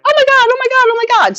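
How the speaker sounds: very high, squealing oh my God; excited squeal. Retold or imitated. Seeing Facebook blow up, a squealing high oh my God. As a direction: squeal with disbelief. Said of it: "And I was like".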